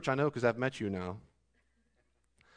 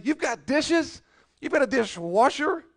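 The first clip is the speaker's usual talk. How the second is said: much higher, slow incredulous question; incredulous rebuke; 12 semitones higher, 7 dB louder, slower than usual. Acted. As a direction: stretch it out in mock disbelief. Preacher rebukes the complainers.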